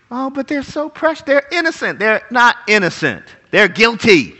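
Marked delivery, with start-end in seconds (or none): 0.1-2.0 doting objector. Retold or imitated. Preacher mimicking people who think children innocent, cooing. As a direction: gush tenderly in a raised mock voice.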